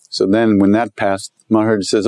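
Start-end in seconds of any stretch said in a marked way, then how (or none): none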